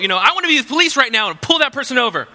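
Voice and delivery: high, loud, fast comic outburst; mock road rage. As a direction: play up the urge to chase someone down. Pretend road-rage police fantasy.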